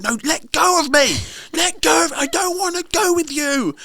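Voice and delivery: put-on little girl refusing to go past the scary man; scared little girl. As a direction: squeal it like a frightened kid being dragged. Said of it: "She said".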